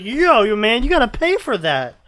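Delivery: high, loud hammed-up vendor; loud high mock shout at the thief; street vendor. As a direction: yell after the thief, mock outrage. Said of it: "he's like".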